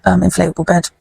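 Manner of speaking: normal talking voice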